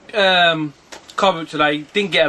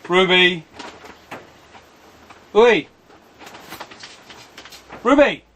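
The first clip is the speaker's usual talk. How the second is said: calling out; 4 semitones higher, slower than usual. Real, not acted. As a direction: get the dog's attention, sharper each time. Calling the dog.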